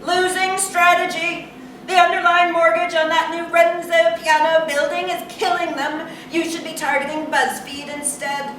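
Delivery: high-pitched student correcting teacher; smug pupil's high rebuttal; know-it-all student. A narrator's reading. Narrator voices a know-it-all student.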